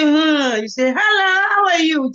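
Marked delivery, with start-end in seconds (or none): 0.0-0.6 loud, high mock "hmm"; drawn-out, put-on snubbing hum; acted snubbing hum. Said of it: "When they say". 0.9-2.1 high, loud, sing-out hello; acted big hello with a smile in it; friendly greeting. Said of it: "give them a good smile".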